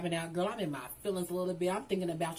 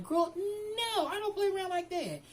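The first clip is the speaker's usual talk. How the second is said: sassy no-nonsense; 12 semitones higher than usual. Real, not acted. Telling the viewers she means business, high and sassy.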